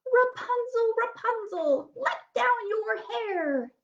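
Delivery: high calling witch voice. A narrator's reading. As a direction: call up to the tower. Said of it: "she would call".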